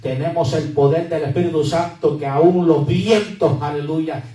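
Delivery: loud, rising declaration of power; full-force preaching. Acted. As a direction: preach it out to the congregation.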